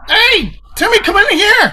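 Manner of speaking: excited sports dad. Acted. Excited football dad calling.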